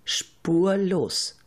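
normal talking voice

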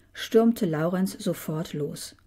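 ordinary delivery

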